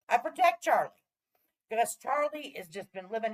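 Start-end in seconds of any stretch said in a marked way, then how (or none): 0.1-0.9 emphatic vow. Real, not acted. Repeated vow, louder.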